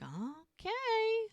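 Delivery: high-pitched, hesitant okay; doubtful retold reply. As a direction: act out a skeptical okay, rising very high.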